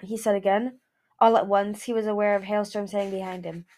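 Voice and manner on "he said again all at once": usual speaking voice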